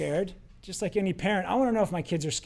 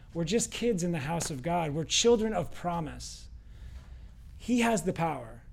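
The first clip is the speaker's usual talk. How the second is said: usual speaking voice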